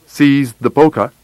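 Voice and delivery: ordinary delivery, nothing marked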